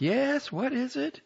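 high call back. A narrator's reading. Wife calling back to husband, high voice. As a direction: answer from another room. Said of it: "she would call back".

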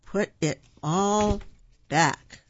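slow, spaced-out command, each word held; drawn-out order. A narrator's reading. Father ordering the junk food put back, drawn-out order.

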